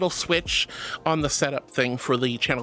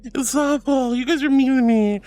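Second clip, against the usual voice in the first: playful whining; 4 semitones higher than usual. Pretend. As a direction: wail at chat as the teased victim. Whining about being teased to death.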